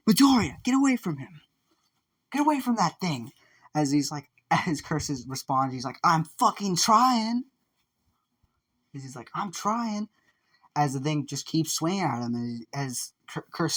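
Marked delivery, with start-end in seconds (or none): 0.1-3.3 high, urgent shouted order; yelled warning. Acted. Teacher ordering Midoriya away from the creature, yelling high. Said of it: "he would yell Midoriya". 6.0-7.5 high, loud, drawn-out retort; exasperated yell. Acted. Curse answering the teacher mid-fight, exasperated high yell. 9.3-10.1 high-pitched protest; exasperated retort. Acted. Curse answering the teacher mid-fight, high exasperated protest.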